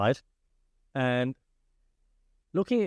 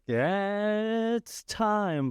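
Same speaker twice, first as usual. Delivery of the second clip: high, drawn-out ring announcer call; Bruce Buffer announcer voice; 8 semitones higher, much slower than usual. Retold or imitated. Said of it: "like, Bruce Buffer voice".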